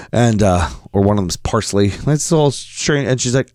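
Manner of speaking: ordinary delivery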